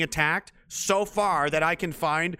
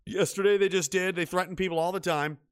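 normal talking voice

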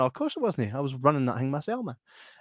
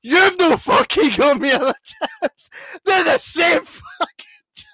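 imitating a furious customer, high and loud; mock yell at the waiter, laughing through it; angry screaming customer; 13 semitones higher, 8 dB louder, slower than usual. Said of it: "And he was screaming at Sam".

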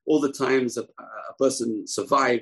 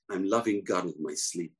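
ordinary talk

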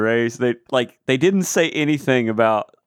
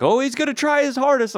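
mocking announcer; 11 semitones higher than usual. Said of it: "they were like".

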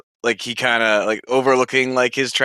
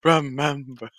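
Lion King ghost father; 3 semitones higher than usual. Retold or imitated. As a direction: imitate a ghostly father's solemn farewell. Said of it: "Lion King, when he's, like".

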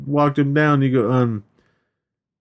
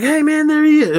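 high-pitched mock call; excited calling out; 3 dB louder than usual. Friends spotting the thief down the street, excited call.

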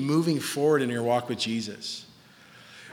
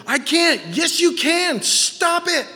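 high, insistent back-and-forth; hammed-up high insistence; mock argument; 12 semitones higher, 4 dB louder, slower than usual. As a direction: act out an exasperated argument at raised pitch. Acted counseling argument, refusal and retort.

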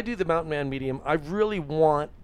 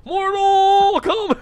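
long high booming shout; drawn-out roaring call; Mortal Kombat yell; 9 dB louder, slower than usual. Pretend. Shouted catchphrase.

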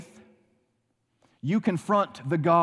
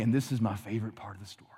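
fast, softer confiding whisper; whispered aside; 7 semitones lower, 7 dB quieter, much faster than usual. Real, not acted. Preacher whispers an aside to the congregation, fast and hushed.

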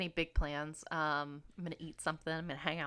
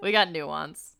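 disappointed; 4 semitones lower, 7 dB louder, slower than usual. Acted. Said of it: "like I was disappointed in her".

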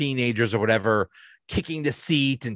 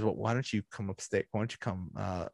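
inviting him up; 3 semitones lower, 9 dB quieter, faster than usual. Retold or imitated. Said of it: "she says".

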